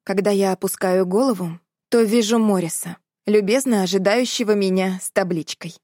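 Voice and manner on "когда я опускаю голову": ordinary delivery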